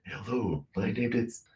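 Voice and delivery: soft metallic robot hello; robot voice, not his own. Shown as a demonstration. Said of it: "Not in my voice".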